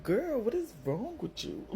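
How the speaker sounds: high, slow, drawn-out disbelief at herself; incredulous scolding. Retold or imitated. Scolding herself the morning after the fight, slow and incredulous.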